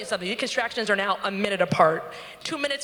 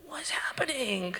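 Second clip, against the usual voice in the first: hushed, drawn-out disbelief; softer, airy retold whisper; bewildered whisper; 8 dB quieter than usual. Bewildered call to her doula.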